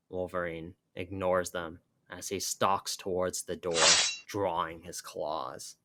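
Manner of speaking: usual speaking voice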